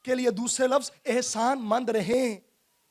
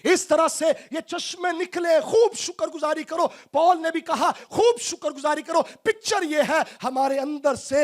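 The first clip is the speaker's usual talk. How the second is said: raised, urging sermon voice; fervent preaching; 4 semitones higher than usual. Acted. Preacher urging the congregation to give abundant thanks, impassioned. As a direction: push it higher, exhort them to overflow with thanks.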